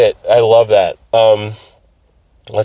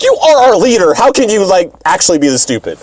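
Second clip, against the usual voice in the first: acting out a fed-up teammate; exasperated scolding; 8 semitones higher than usual. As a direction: scold in high-pitched disbelief. Mars berates Sailor Moon.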